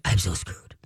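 low, hushed dog voice; defeated little aside, very low; doomed dog. Acted. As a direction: sink low and soft, in deep trouble. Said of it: "the dog is like".

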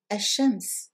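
Ash-Shams, l merged into double sh; Ash-Shams with a long doubled sh; sun letter example. Shown as a demonstration. Teacher introduces the sun word with assimilation. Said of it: "let me introduce you now to this word".

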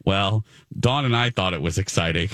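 usual speaking voice

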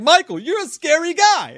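high, loud Goodfellas tough-guy mimicry; Ray Liotta impression. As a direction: ham up the menacing cop's taunt. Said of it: "sounds exactly like the".

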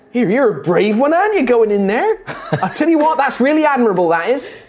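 high, chirpy praise with a laugh; admiring halfling. Acted. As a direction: cheer the brave newcomer on. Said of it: "Little halfling's like".

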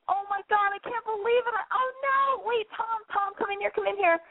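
very high, hammed-up distress; pretend panicked caller. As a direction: play a girl frantically calling her boyfriend in. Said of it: "I'm gonna be you".

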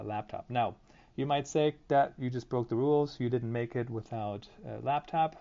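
normal talking voice

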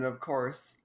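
ordinary delivery

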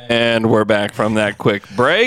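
slow, loud show-host return; announcer voice. Acted. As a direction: welcome listeners back like a radio host.

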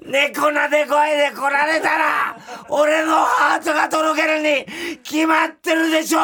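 high, loud frantic ranting; frantic shout. Acted. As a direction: yell it like a lovesick tantrum. Reads ranting letter in outburst. (半泣きで叫ぶ)

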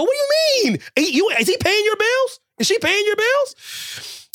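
high, incredulous squeal at the doubters; mock outraged squeak. Scolding fans who doubt the wrestler, squeaky mock outrage. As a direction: pitch way up in mock disbelief. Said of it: "I go".

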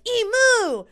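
high, loud mock bird cry; answering emu call. Other emus answering the first, very high loud cry. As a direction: blare it back as an answering emu. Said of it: "the other ones would be like".

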